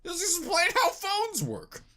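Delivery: high, slow mocking voice; high, drawn-out mock wonder; mock writers. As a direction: play the lazy writers in a high silly voice. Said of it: "it was like".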